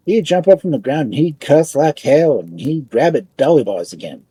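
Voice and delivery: ordinary delivery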